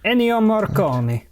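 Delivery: name said with a high Italian lilt; Italian accent. Retold or imitated. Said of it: "I'm gonna stop doing the Italian accent".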